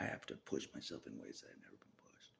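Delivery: low unvoiced whisper. Real, not acted. Whispering to himself about pushing harder, hushed. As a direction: push it out under your breath.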